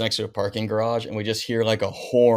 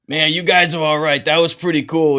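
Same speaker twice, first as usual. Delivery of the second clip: put-on higher, louder East Coast accent; a gruff-but-won-over East Coast guard; JoJo impression; 7 semitones higher, 7 dB louder than usual. The security guard warming up to them, East Coast accent. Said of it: "I love your JoJo impression".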